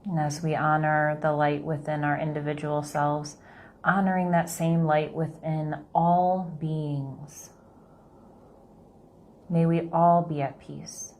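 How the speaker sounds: slow, soft meditation voice; guided meditation. Acted. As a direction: gently guide them through the breath.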